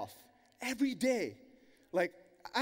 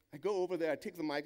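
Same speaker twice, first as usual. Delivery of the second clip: normal talking voice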